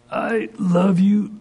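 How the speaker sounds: slow, high, strained restraint; biting his tongue. Retold or imitated. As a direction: force out tender words while holding back a scolding. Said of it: "he'd bite his tongue".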